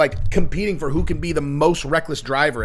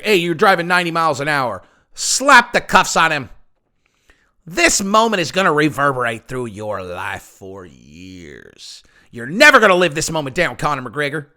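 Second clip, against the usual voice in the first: Kentucky Fried Chicken cop; 4 dB louder than usual. Retold or imitated. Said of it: "the Irish cop sounds like a Kentucky Fried Chicken".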